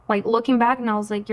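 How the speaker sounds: ordinary talk, nothing marked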